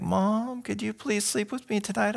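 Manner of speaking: put-on high, soft plea of a frightened child; small high-pitched child voice, pleading with mom; scared little boy. Little boy begging mom to stay during the thunderstorm, scared. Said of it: "he started to tremble a little bit".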